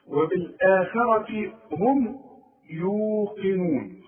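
يوقنون with the واو stretched, slow; madd on waw. Shown as a demonstration. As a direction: lengthen the waw. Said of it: "والواو دي من حروف المد". (مد الواو في يوقنون)

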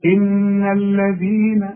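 slow, drawn-out chanted recitation; Quran recitation. Shown as a demonstration. Teacher chanting the verse for a student to copy. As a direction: recite the verse slowly, stretching it. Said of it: "teacher recites verse".

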